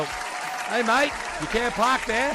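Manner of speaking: high, slow, drawn-out Australian "mate"; mock Aussie local calling from a car; hammed-up Australian accent; Australian bloke.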